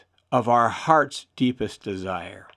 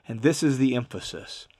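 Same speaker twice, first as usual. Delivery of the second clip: ordinary talk, nothing marked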